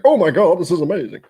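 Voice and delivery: high, loud burst of mock amazement; mock gushing. Streamer parodies being blown away by a whisky, mock gushing. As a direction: gush as if blown away, hammed-up.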